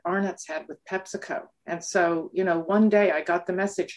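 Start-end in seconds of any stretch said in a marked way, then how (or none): none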